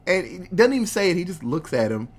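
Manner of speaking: plain reading voice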